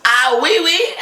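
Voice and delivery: high squealing laugh; a real laugh breaking into the words; bursting into laughter. Real, not acted. Host cracks up at story.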